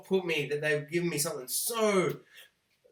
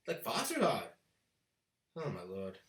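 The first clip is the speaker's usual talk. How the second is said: much lower, much softer groan; quiet, low mortified sigh; 9 semitones lower, 11 dB quieter than usual. Real, not acted. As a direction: sink into embarrassment.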